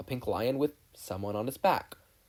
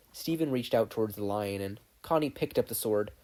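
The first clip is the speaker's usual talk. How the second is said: usual speaking voice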